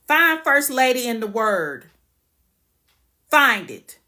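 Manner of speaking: loud, slow, angry demand; heated challenge. Real, not acted. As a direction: hammer each word like a dare. Heated rant against church titles.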